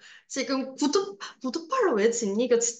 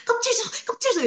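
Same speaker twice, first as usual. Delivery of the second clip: greedy shouting; 13 semitones higher than usual. Retold or imitated. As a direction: yell it like a desperate grabby child. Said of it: "막 이렇게 막 극악스럽게".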